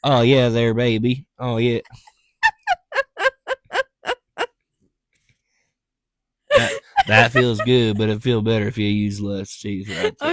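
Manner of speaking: mock Viking lover. Viking lover coaching his partner, hammed up. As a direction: play the Viking lover again, loud and silly.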